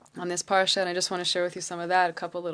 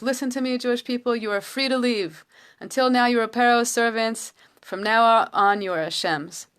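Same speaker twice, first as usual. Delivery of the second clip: raised, higher voice of a proclaiming king; loud, ringing proclamation; 5 semitones higher, 5 dB louder than usual. Retold or imitated. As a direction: call it out to a whole people, measured and ringing. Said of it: "with this loud, ringing voice".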